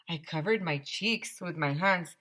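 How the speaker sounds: ordinary delivery, nothing marked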